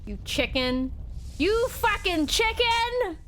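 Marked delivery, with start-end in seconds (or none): 1.4-3.2 high, slow furious yell; outraged high-pitched taunt; furious insult. Real, not acted. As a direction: drag out the insult in a rage. Insulting a fleeing character.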